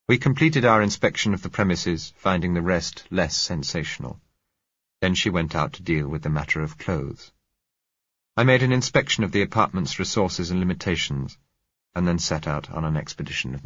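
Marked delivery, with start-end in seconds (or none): none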